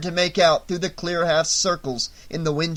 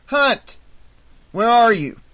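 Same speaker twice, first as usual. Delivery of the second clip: loud, higher call to the deputy; urgent radio hail; 7 semitones higher, 8 dB louder than usual. A narrator's reading. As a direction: snap his name, then demand. Sheriff calling deputy on radio.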